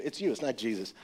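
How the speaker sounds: usual speaking voice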